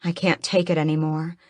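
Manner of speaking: usual speaking voice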